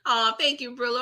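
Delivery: ordinary talk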